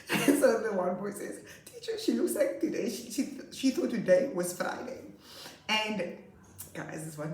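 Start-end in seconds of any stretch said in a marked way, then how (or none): 1.6-5.0 a hint of an eager schoolboy; boy telling teacher. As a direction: lightly mimic a little boy reporting to teacher. Imitating boy telling on classmate.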